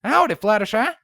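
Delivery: bright, too-cheery greeting; overly cheerful. A narrator's reading. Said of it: "greeted a little too cheerfully".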